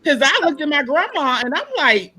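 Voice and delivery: normal talking voice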